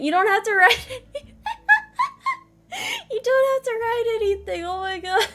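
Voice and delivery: high pitched laughing disbelief. Real, not acted. Caught off guard by chat.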